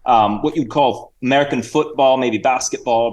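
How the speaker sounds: chatty put-on Cockney-Australian accent; Sir Reginald. Guest introduces the hosts to sport in fake Cockney-Australian accent. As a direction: ramble as a mock Cockney-Aussie guest. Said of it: "bit of a Cockney accent and Australian".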